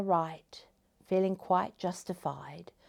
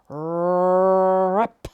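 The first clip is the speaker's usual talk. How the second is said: loud, drawn-out trilled rip; ripping sound; 10 dB louder than usual. A narrator's reading. Storyteller voicing the cocoon being torn, ripping noise. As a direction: imitate a cocoon tearing open, loudly. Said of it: "the sound of a cocoon being torn".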